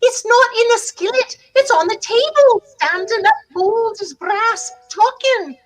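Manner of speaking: a wife insisting she is not mad; wife arguing with her husband; shrill insistent farmwife. Acted.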